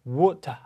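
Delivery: water with a clear T and no final R; British, no R. Shown as a demonstration. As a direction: say water the British way, ending "tah". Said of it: "이런 발음을 한다". (water 끝 R을 빼는 영국식 발음)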